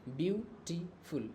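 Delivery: "Beautiful" slowly, as view, टी, फुल; split into three syllables. Shown as a demonstration. Teacher counting syllables for students, "beautiful" in three pieces. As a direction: break "beautiful" into three slow chunks. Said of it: "ये तीन टुकड़ा हुआ ना इसका".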